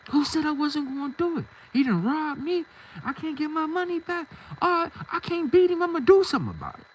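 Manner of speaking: high-pitched, defiant comeback; mad. Retold or imitated. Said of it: "I'm mad".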